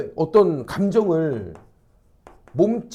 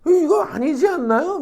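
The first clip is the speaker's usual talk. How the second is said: high, drawn-out mock appeal; seeking agreement; 10 semitones higher than usual. Onlooker asking everyone to agree, exaggerated high appeal. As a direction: slowly plead for backing, pitched way up. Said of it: "막 동의를 구하고 있는 거야".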